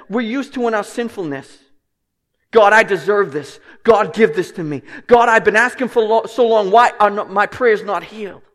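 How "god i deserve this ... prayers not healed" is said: loud, demanding prayer; entitled demand. Pretend. Sinner demanding healing from God, loud and entitled. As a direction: demand it from God like he owes you. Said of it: "none of that sinful posture".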